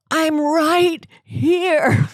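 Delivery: very slow, high, drawn-out insistence; mock driver voice. Driver insisting to stranded passengers, very slow and high. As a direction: stretch it out like a spaced-out driver. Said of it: "And she said".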